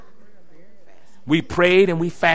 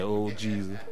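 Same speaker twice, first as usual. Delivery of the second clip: mock crying prayer; 11 semitones lower, 12 dB quieter, slower than usual. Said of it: "I was crying".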